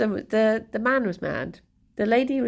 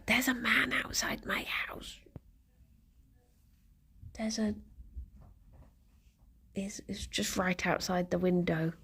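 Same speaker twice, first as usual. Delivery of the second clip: hushed whisper, much softer; 9 dB quieter than usual. Real, not acted. Whispering to viewers about a man at her window. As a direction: whisper so the man outside won't hear.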